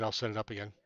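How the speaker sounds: ordinary delivery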